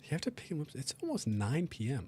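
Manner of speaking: ordinary talk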